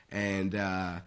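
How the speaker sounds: ordinary delivery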